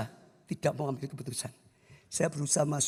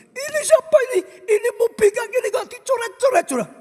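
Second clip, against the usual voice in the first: shrieking high angry outburst; furious father; 8 dB louder, faster than usual. Retold or imitated. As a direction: scream it like an enraged dad finding his car scratched. Ayah marah melihat mobil dicoret. (ayah berteriak marah)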